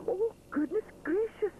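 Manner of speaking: high, shocked gasping exclaim. A narrator's reading. Mister Sponge hearing Edward is trapped, shocked exclaim. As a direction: caught off guard. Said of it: "exclaims Mister Sponge".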